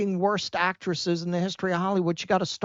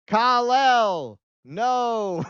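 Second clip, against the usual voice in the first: mock overwrought movie cry, long drawn vowels; mock bad acting; 6 semitones higher, 3 dB louder, much slower than usual. Host mocks the actress's infamous movie line, overwrought and drawn out. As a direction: stretch it out high like a bad actress. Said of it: "she's infamous for being an awful actress".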